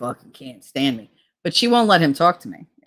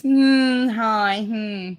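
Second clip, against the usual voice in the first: high, drawn-out mock hum and hello; fake tight-lipped hello; 6 semitones higher, 3 dB louder, much slower than usual. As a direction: squeeze out a snooty, phony hi. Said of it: "with the face".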